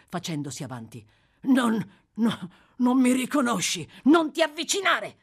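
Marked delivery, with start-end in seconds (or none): 1.4-3.8 higher, louder insisting; urgent plea. Acted. Sykes pleads with Charlie, urgent and louder. 4.0-5.2 high, loud frightened yell; terrified cry. Acted. Charlie recoils from the murderer, terrified cry. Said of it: "esclamò il ragazzo".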